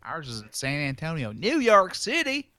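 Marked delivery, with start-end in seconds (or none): 1.3-2.5 high, slow, shouted cowboy outrage; salsa commercial cowboy. Retold or imitated. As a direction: yell it like the old salsa ad. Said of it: "remember those commercials".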